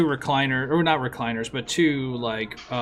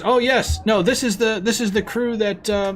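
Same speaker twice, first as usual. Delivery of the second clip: nervous subordinate; 7 semitones higher, 3 dB louder than usual. Acted. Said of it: "And he says".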